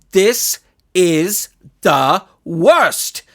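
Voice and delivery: slow, drawn-out disgusted wail; the coach moaning in disgust; disgusted groan. Acted. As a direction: stretch it out in despair. Coach throws clipboard in disgust.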